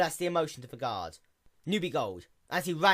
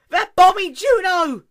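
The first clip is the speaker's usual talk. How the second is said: very high, loud, slow scream; alarmed scream; 18 dB louder than usual. Acted. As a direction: shriek it in horror. Luna cries out in alarm.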